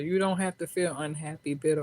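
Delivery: normal talking voice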